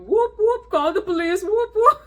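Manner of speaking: high, loud mocking siren call; mock him like a whooping siren; mocking imitation. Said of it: "mocking him and going".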